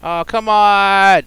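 building, high, loud pleading yells; urgent yelling. Real, not acted. Gamer yelling while chasing a fast pig.

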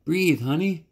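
high, pleading plea to breathe; a brother's desperate plea. Acted. Boy pleading over dying sister.

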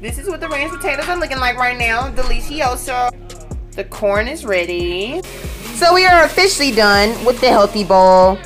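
ordinary talk, nothing marked

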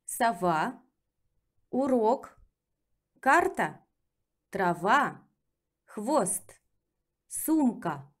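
brisk natural-pace repeat; second reading. Shown as a demonstration. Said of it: "let's practice with reading".

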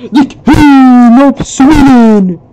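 slow, loud, high royal proclamation; grand announcer. Acted. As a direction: announce it like a herald.

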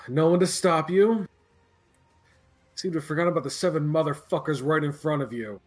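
shouting. Acted. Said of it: "He shouts".

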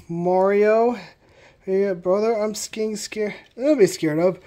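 usual speaking voice

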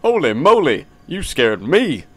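hammed-up game character with swooping pitch; exaggerated sing-song character delivery; put-on character voice. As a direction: play the startled stranger in a silly voice. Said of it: "give this guy a fitting voice".

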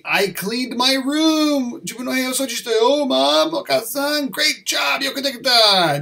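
high-pitched, delighted role-play; excited calling out. Acted. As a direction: act out proudly telling Mom and being praised. Acted child and mother exchange.

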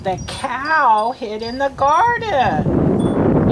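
slow, clear read-aloud; slow model reading. Shown as a demonstration. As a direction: read it slowly for the children. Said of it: "You read it".

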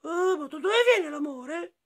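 very high, put-on animal voice; high-pitched wondering moose character; moose asking. A narrator's reading. Moose suddenly asking friends about love, high character voice. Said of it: "D'un tratto alce chiede".

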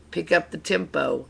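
usual speaking voice

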